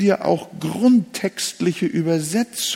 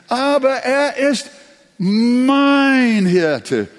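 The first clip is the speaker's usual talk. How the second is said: "mein" loud and high after a raised "Aber"; emphasis on "mein", loud and higher; stress on mein; 8 semitones higher, 6 dB louder, much slower than usual. Shown as a demonstration. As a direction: drive "mein" home. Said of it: "was steckt denn da wieder drin".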